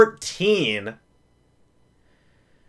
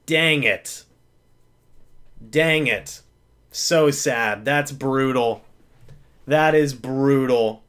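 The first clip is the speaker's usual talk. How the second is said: dejected; 7 semitones lower, 4 dB quieter, slower than usual. Real, not acted. Dejected after loss of game.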